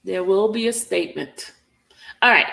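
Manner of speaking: usual speaking voice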